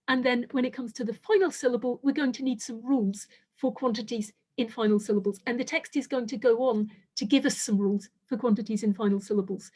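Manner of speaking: normal talking voice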